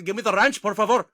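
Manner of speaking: mock Mexican accent, showing off; bad Mexican accent. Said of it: "talking with a Mexican accent".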